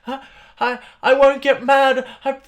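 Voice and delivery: high, loud, overwrought apology; mimicking a flustered person spiralling into upset; mock upset apology. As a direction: ham up a tearful over-apologizer. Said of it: "And they're like".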